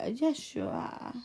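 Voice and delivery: normal talking voice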